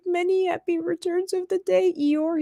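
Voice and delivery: high-pitched, tearful little Piglet; mock tearful. Crying Piglet greets Eeyore on his birthday, mock tearful. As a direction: lightly imitate a teary well-wisher. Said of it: "and he's crying".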